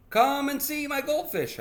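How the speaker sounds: put-on high, loud kid voice; excited child calling. Boy calling mother to see goldfish, excited. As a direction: call out high to show it off.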